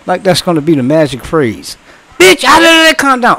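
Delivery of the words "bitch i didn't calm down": high, loud mock retort from a furious caller; hammed-up outraged caller yelling back; mock angry shout. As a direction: snap back like a caller who won't be told to calm down.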